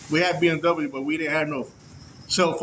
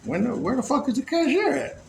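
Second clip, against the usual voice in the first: confused shopper; 7 semitones higher than usual. Retold or imitated. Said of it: "I'm like".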